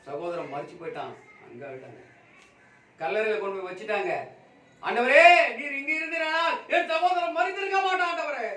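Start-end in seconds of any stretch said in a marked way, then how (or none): 4.8-8.5 loud, high-pitched grieving cry to the Lord; raised-voice lament, pleading in grief; anguished plea. Acted. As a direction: act out a bereaved sister crying out in pain. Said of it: "எவ்வளவு பெரிய வேதனை பாருங்க".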